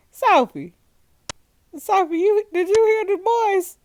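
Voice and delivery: high, loud, playfully urgent summons; urgent calling out. Pretend. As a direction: call her over with big news. Urgently calling game contact Sophie.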